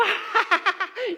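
high, loud pointing-and-laughing cackle; loud re-enacted ha-ha-ha; mock laughter. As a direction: burst out in mocking high laughter.

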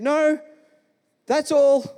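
high-pitched mock little-boy reply; imitating young self. Young boy answers the neighbour.

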